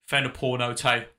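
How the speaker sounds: ordinary delivery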